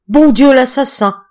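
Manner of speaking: a terrified man choking out his accusation; strangled voice. A narrator's reading. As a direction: gasp it out as if your throat is squeezed shut. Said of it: "fit-il d'une voix étranglée".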